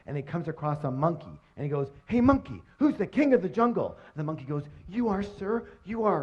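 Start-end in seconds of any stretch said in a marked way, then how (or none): 2.1-4.0 high, demanding lion voice; swaggering bully lion asking; bossy lion. Acted. Lion bullies jungle animals. 4.9-6.2 a hint of a high, meek monkey; lightly voiced cowering monkey; timid monkey. Monkey answers lion timidly.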